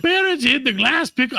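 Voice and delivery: high, badly faked German accent; failed German accent. Host retelling the pickle legend, botched German accent. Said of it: "I can't fucking do it".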